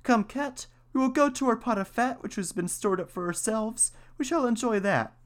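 very high mouse voice; mouse character. A narrator's reading. Mouse invites cat to their winter store, high-pitched. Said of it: "the mouse thought of their provision and said".

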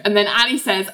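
ordinary talk

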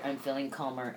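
usual speaking voice

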